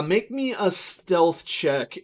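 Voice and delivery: ordinary talk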